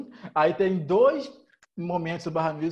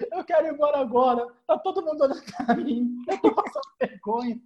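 high-pitched hammed-up tantrum; mock tearful whining; 8 semitones higher than usual. Imitating friend after spilling Coke, mock tearful whine. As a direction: whine it like an embarrassed drunk in tears.

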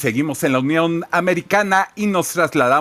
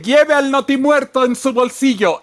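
announcer voice; 4 semitones higher than usual. Acted. Announcer sign-off.

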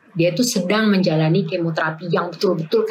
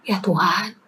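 whisper; slower than usual. Retold or imitated. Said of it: "Ngomong sama Tuhan".

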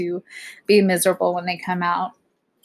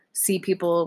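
usual speaking voice

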